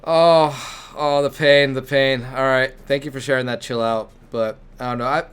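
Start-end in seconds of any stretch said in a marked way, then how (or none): 0.0-2.2 loud, hammed-up suffering; mock agony. Host reacting to a friend's fail story, mock agony. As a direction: groan it out in mock pain.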